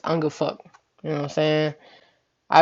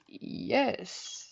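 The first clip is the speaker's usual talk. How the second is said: whispered yes; 5 semitones higher, 11 dB quieter than usual. Retold or imitated. Numbly agreeing, shocked.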